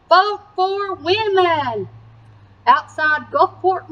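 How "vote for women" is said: higher and slow, ringing out; slow proud slogan. A narrator's reading. Nell and Alice telling crowds, slow proud cry. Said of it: "Nell and Alice told them".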